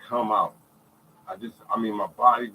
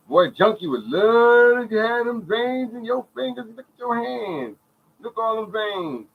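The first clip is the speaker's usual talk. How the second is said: high, slow, stretched-out marvelling; hammed-up admiration, pitched way up; mimicked amazement; 11 semitones higher, 4 dB louder than usual. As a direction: marvel in a raised, drawling imitation. Mimicking amazement at his veins.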